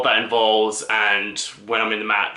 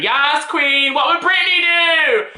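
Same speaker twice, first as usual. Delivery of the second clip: sideline cheer; 5 dB louder, slower than usual. Retold or imitated. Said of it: "I was there on the sideline going".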